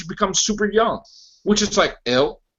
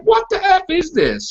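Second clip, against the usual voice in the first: incredulous; 10 semitones higher than usual. Retold or imitated. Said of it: "I'm like".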